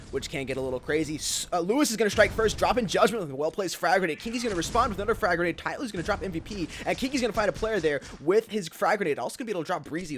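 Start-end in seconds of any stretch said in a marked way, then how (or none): 1.2-10.2 loud, fast esports call; play-by-play. Real, not acted. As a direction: call the action live, hyped.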